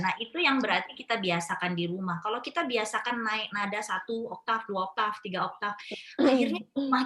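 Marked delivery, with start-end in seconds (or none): none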